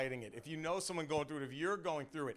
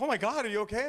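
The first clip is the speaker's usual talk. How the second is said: high, loud burst of alarm; mock panic on his partner's behalf; alarmed fiancée; 9 semitones higher, 10 dB louder, faster than usual. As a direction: exclaim it loud and high, imitating a worried fiancée. Fiancée's alarmed reaction acted out.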